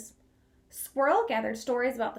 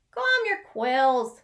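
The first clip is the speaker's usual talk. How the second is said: slow, louder calming words; reassuring Bunny; 7 dB louder, much slower than usual. Acted. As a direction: soothe a nervous friend. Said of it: "said Bunny".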